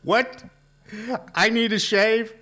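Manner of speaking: high indignant what, I need a shave; mock-offended surprise; surprised. Real, not acted. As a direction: pitch jumps up in disbelief. Surprised at being told to shave.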